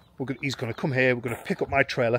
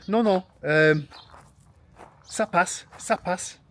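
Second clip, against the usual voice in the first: put-on old French lady; 6 semitones higher, much slower than usual. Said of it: "she went".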